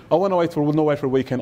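normal talking voice

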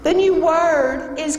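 high, slow Southern drawl; mock-grand Southern belle announcement; Southern belle accent. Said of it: "the Southern belle with the accent".

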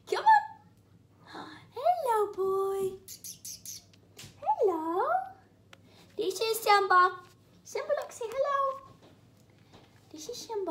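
high sing-song voice for the cats; high cat talk. Real, not acted. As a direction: coo at the cat to lure it over. Cooing at cats.

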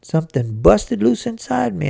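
usual speaking voice

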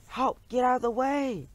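high panicked yell of the crowd; high frantic crowd cry; screaming for help. A narrator's reading. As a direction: shout it as if a skateboard is bearing down. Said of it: "everyone was screaming".

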